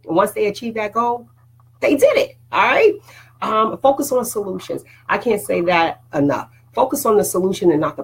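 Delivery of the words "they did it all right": excited cheer. Real, not acted. Celebrating achievement.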